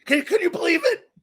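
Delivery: high, breathless disbelief of a starstruck fan; gushing fan. Retold or imitated. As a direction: squeal it like you can't believe your luck. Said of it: "Sitting there going like".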